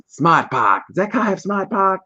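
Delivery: high, hammed-up Boston voice, "Park" said "Pahk"; Boston accent. Host imitating Boston people in a Super Bowl ad. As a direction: ham up a Boston guy bragging about his car. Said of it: "ran around work doing that".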